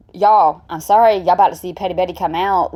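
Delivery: ordinary delivery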